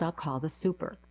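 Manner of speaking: ordinary delivery, nothing marked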